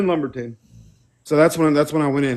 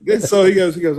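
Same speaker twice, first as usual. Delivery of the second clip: ordinary talk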